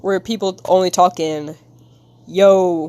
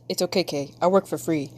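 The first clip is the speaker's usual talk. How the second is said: ordinary talk, nothing marked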